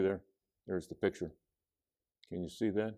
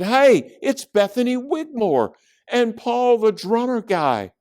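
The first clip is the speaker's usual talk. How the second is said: put-on high, loud kid's exclamation; excited calling out; 6 dB louder than usual. A narrator's reading. Judy spots friends across the field, high and excited. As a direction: call out high on spotting them. Judy points, spotting college kids.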